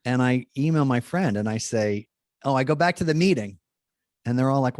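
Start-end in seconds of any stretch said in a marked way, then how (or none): none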